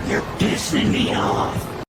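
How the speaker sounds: slow, low breathy whisper, drawn out. Pretend. Repeating the angry text to the viewers in a slow whisper. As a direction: hiss it slowly under the breath.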